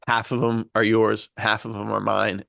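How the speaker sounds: ordinary talk, nothing marked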